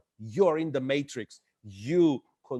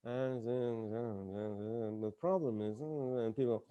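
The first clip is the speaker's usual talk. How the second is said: much softer, halting uh uh before the problem is; hesitant mumbling; 5 semitones lower, 11 dB quieter than usual. Shown as a demonstration. Nervous presenter stalling before investors, soft and halting. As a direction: trail in weakly with no confidence. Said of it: "You don't go".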